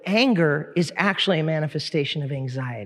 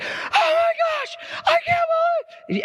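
freaking out. Retold or imitated. Teller imitating a girl after a car accident, freaking out. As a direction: imitate a girl screaming in panic. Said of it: "this one girl in the car starts freaking out".

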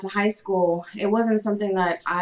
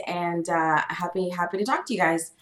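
usual speaking voice

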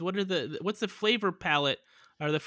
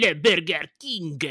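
loud, hammed-up fake French; attempted French accent; 7 dB louder than usual. Shown as a demonstration. Trying to impress the Frenchman, loud French accent. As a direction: belt it in broad mock French. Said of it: "let me try one more time".

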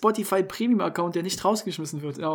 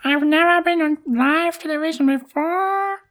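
put-on slow, higher English copy of the clip's kid; imitating Apparently Guy; 9 semitones higher, 4 dB louder, slower than usual. Host imitates the boy from the TV clip, slow and higher. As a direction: parrot the boy's dreamy line, slowly. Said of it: "Apparently Guy".

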